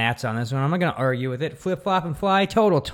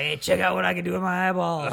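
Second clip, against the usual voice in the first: higher, goofy boast; tipsy Lobo; 8 semitones higher than usual. Retold or imitated. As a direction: show off like a giddy drunk. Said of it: "Lobo was like".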